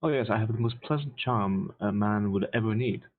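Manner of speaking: usual speaking voice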